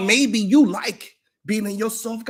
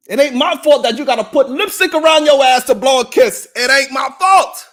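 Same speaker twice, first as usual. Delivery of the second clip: shouting; 4 semitones higher, 9 dB louder than usual. Real, not acted. Mocking shouted insult.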